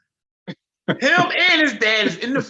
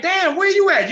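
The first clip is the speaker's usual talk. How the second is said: shocked; 11 semitones higher, 4 dB louder than usual. Retold or imitated. Dad shocked by daughter's location.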